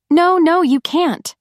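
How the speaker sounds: high, loud frightened protest; panicked cry. A narrator's reading. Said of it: "Missy cried".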